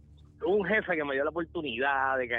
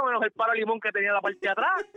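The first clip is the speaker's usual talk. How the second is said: usual speaking voice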